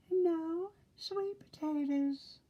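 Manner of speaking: high, slow little pig correcting his brother. Acted. Pinky correcting Oinky, high and slow. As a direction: correct him in a high piggy voice.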